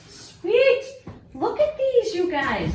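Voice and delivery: high, loud delight; excited squeal. Real, not acted. Showing viewers new fabric packs, squealing with delight.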